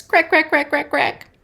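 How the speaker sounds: high, quick run of cracks; nut-cracking noise. A narrator's reading. Squirrel cracking nuts near Owl, high rapid cracking. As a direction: fire off each crack sharply, high. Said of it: "Squirrel cracked nuts".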